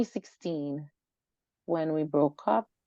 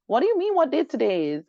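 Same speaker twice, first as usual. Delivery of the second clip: indignant; 6 semitones higher, 5 dB louder than usual. Retold or imitated. Retelling her indignant question to him.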